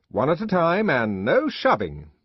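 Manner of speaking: high, loud stern command, stressed no; peremptory order. A narrator's reading. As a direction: lay down the rule firmly. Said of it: "he said peremptorily".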